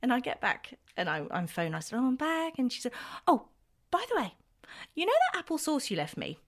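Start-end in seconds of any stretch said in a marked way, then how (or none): none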